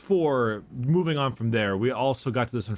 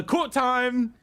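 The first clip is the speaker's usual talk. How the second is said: sing-song announce; 10 semitones higher than usual. Pretend. Announcing a segment.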